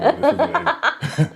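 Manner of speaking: high laughing. Real, not acted. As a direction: squeal it while laughing.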